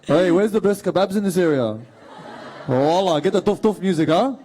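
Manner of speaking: brash Punchbowl-accent street banter. Shown as a demonstration. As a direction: call out like a Punchbowl lad, accent thick. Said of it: "Can you please do the Punch Bowl accent?".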